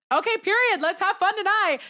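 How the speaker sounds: high-pitched, excited retold cheer; thrilled. Her answer to "I do now", high and thrilled. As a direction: burst out in playful celebration.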